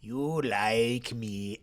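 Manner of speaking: slow, drawn-out mock Middle Eastern accent; retold punchline in a slow Middle Eastern accent; imitated Middle Eastern accent. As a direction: draw the words out as an imitated Middle Eastern client. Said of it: "I don't know what his accent is".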